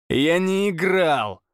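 slow, stressed denial; defensive. Acted. Pavel denying Oleg's accusation, defensive cry. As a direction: dig in on the denial.

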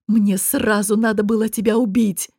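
usual speaking voice

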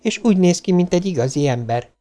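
put-on high child's voice, chatty and earnest; small girl describing her friend to a robot; eager little girl.

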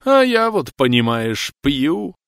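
put-on high, loud tearful drunk. A narrator's reading. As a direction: speak through drunken tears. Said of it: "со слезой в голосе произнёс".

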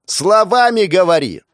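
high, loud shout. Acted. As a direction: yell it at the deaf old woman. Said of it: "прокричал Жуков".

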